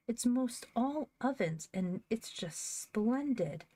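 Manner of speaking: high and slow, savouring each word; marvelling at the new stove; delighted. Acted. Girl telling her mother about the stove, slow and marvelling.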